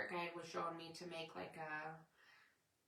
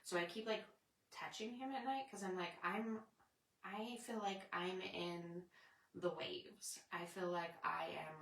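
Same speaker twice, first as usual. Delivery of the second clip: ordinary talk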